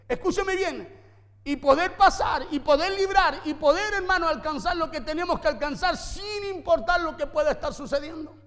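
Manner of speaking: loud, driving sermon delivery; forceful preaching. Acted. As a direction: preach it hard to the congregation.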